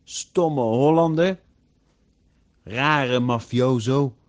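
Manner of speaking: slow, loud name-calling; loud mock insults. A narrator's reading. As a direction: draw out each insult at full voice. Friends trade mock insults loudly.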